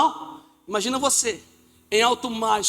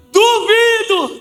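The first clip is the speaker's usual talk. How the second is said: slow, loud, higher doubting drawl; 8 semitones higher, 10 dB louder, much slower than usual. Acted. Peter doubting the figure on the water, loud defiant challenge. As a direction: challenge him, dare him.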